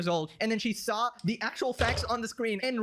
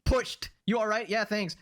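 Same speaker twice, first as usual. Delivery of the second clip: normal talking voice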